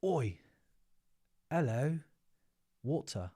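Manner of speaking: amateur Aussie accent; attempted Australian twang. Shown as a demonstration. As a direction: practise an Aussie accent word by word. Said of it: "my, my Aussie".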